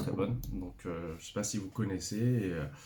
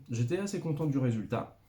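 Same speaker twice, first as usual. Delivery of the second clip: ordinary talk, nothing marked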